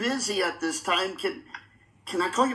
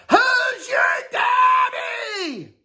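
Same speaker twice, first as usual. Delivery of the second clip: high, slow, drawn-out victory yell; triumphant yell; 7 dB louder, much slower than usual. Pretend. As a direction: roar it like a champion. Triumphant champion call.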